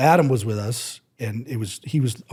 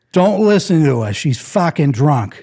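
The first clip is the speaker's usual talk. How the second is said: higher, louder, blunt reassurance; boyfriend's outburst; 5 semitones higher, 8 dB louder than usual. Retold or imitated. Boyfriend excusing his drunk girlfriend, loud and blunt. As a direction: blurt it out over the bar noise. Boyfriend defending him loudly.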